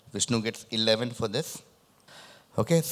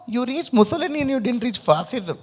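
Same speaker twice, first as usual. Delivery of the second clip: high, laughing through the words; 13 semitones higher, 4 dB louder than usual. Real, not acted. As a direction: laugh while teasing. Host laughs at the wrong guess.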